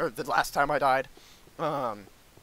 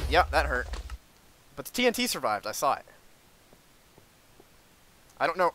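normal talking voice

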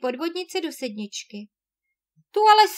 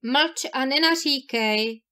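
plain reading voice

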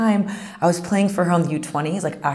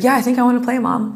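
pitched up, a touch eager, answering mom; higher eager kid voice; 7 semitones higher, 4 dB louder than usual. Retold or imitated. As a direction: play the hopeful teen, voice lifted high. Teen answering her mom.

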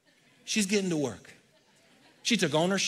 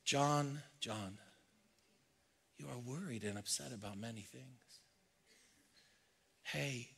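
hushed, intimate, much softer; tender Jesus; 3 semitones lower, 13 dB quieter than usual. Pretend. Jesus speaking to a worried listener, gently hushed. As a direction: murmur it like comfort to a weary friend.

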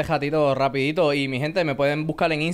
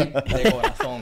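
laughing; 3 semitones lower, slower than usual. Real, not acted. Repeats with laugh, slower.

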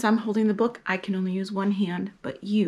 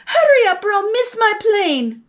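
high, loud, near-crying plea; anxious passenger; 10 semitones higher, 6 dB louder than usual. Acted. Woman in taxi urging the driver, high crying plea. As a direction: beg the driver, voice cracking high. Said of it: "she told the taxi driver".

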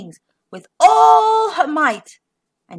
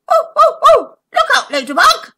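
high, loud, fast chatter; excited monkey chattering; 14 semitones higher, 9 dB louder than usual. Acted. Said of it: "he chattered".

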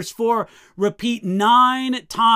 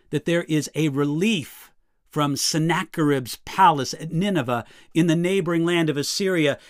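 ordinary delivery

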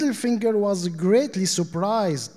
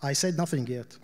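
usual speaking voice

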